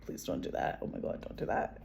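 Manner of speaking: mock pleading, dropping away almost to a whisper; soft, voiceless imitation of nagging; imitated pleading. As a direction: act out a hushed, low running plea. Niece's nagging acted out.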